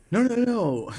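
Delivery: imitated loud, high backpedalling; high-pitched protest. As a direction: mimic a hasty denial, voice jumping way up. Retold friend's hasty protest.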